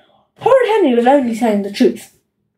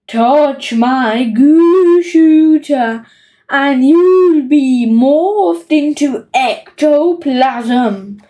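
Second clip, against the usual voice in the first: very slow, drawn-out ghostly groan; spooky groan; much slower than usual. Acted. Henry warns thieves off his goo shooter, spooky groan. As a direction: stretch each word like a haunting phantom. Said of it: "he groaned spookily".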